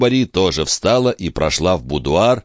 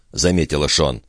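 ordinary delivery

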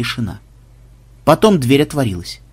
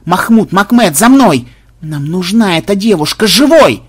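loud, higher call to action; urgent command; 5 semitones higher, 8 dB louder than usual. A narrator's reading. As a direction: bark the orders to give chase. Xenobyte orders the chase. (громкий приказ бежать следом, выше и громче)

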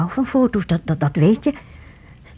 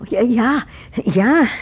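scared hurried yes; 5 semitones higher, 5 dB louder, slower than usual. Acted. Frightened mother forced to agree.

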